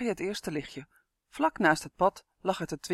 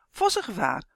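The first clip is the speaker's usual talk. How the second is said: urgent alarm call, slightly raised; Dummy warning; 3 semitones higher, 4 dB louder than usual. A narrator's reading. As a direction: sound the alarm. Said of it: "waarschuwde Dummy".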